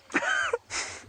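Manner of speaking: sudden high-pitched laugh; high burst of laughter. Real, not acted. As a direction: laugh at your own bad accent. Laughing at own bad accent.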